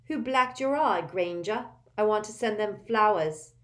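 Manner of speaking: a touch of a high, taunting brat; sneering schoolboy. A narrator's reading. Malfoy taunting Hermione about her eye, mildly voiced sneer. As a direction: jeer at her, lightly voiced. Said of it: "sneered Malfoy".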